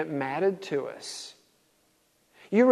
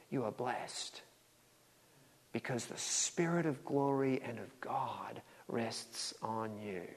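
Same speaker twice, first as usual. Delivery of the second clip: near-whispered, slow reverent reading; hushed scripture reading; 5 semitones lower, 13 dB quieter, slower than usual. Acted. Preacher reading Peter's blessing to the church in a hush. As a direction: breathe the promise quietly to the church.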